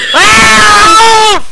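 high yelling; panicking gamer. Real, not acted. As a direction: shout it at your teammate. Yelling at teammate.

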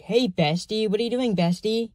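high-pitched mock bestie voice; patronizing pity friend. Fake friends visiting his table, mock patronizing. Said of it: "like, a patronizing, like, pity friend".

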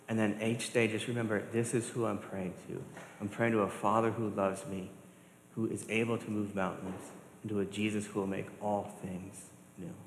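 normal talking voice

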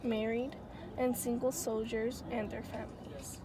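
quiet. Real, not acted. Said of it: "I need to whisper".

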